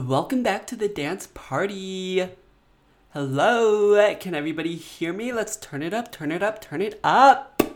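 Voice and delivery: hyped-up party host shouting; booming sing song welcome. Pretend. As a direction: pump up the crowd, loud and high. Hosts a pretend dance party.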